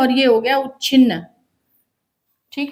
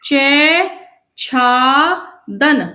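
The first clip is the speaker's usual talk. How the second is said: each syllable drawn out very slowly; slow spelling out; much slower than usual. Shown as a demonstration. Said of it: "करके देखो".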